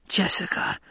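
breathless unvoiced whisper of a name. A narrator's reading. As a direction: whisper her name, stunned. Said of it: "she whispered".

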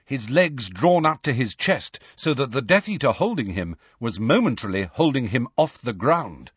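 ordinary delivery, nothing marked

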